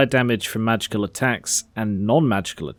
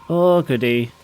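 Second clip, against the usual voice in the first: ordinary talk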